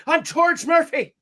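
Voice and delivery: high, drawn-out mock Irish accent; fake Irish accent. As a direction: overdo a lilting Irish accent on the name. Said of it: "put on the Irish accent".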